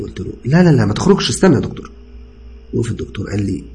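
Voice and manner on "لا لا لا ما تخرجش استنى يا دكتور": high, loud rapid-fire refusal; urgent objection. A narrator's reading. Patient stopping the doctor at the door, urgent. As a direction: stop someone from leaving.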